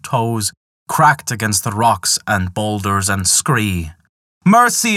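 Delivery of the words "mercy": much higher, louder plea; imploring cry. Acted. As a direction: beg for mercy at the top of the voice. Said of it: "implored Loki".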